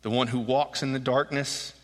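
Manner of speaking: solemn verse read aloud; scripture reading. A narrator's reading. Re-reads the verses.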